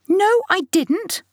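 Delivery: high, slow indignant denial; boy exclaiming. Acted. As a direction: protest hotly, way up high. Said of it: "Oliver exclaims".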